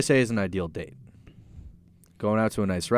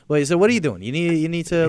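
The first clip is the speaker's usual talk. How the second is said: Long Island accent; 5 semitones higher than usual. Real, not acted. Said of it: "my Long Island accent coming out".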